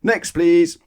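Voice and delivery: high, loud brusque call; loud dismissal. Acted. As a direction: wave the next customer on loudly. Grocer dismissing customer.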